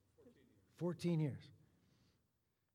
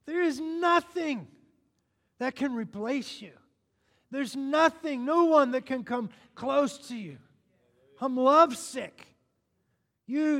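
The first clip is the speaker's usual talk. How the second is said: high, loud impassioned preaching; slowing to a loud lovesick cry at the end; fervent preaching; 5 semitones higher, 8 dB louder than usual. Acted. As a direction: pour it out to the bride as a passionate plea. Preaches at full force.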